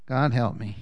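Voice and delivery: normal talking voice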